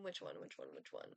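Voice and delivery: a sudden very low, soft mumble; a low grumbling pretend voice, pondering the options; deep mock mumble. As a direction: drop the voice way down and grumble to yourself. Muttering while choosing an option.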